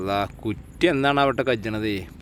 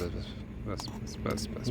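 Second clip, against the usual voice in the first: whispered; 3 semitones lower, 13 dB quieter, slower than usual. Real, not acted. Quietly stopping the serving.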